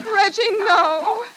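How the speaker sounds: high, pleading cry of fear; sharp rising plea, afraid; frightened plea. Acted. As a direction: beg him to stop, terrified. Terrified plea to strangler.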